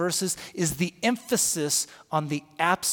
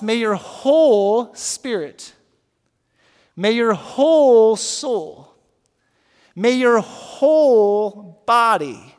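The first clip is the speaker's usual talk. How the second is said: slow, loud, whole stressed each time; 4 semitones higher, 10 dB louder, much slower than usual. Shown as a demonstration. Pastor reading Paul's blessing, slow and loud. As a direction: press on whole, slowly. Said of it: "Paul is saying here".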